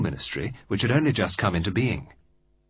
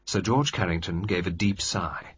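ordinary talk

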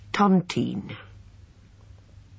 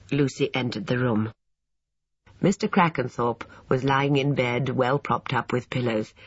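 plain reading voice

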